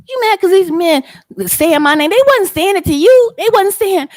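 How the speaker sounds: loud, high-pitched challenge; heated confrontation. Real, not acted. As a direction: call them out at full volume, indignant. Heated confrontation with absent rival.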